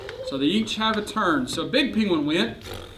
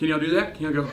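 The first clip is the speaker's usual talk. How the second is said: ordinary delivery, nothing marked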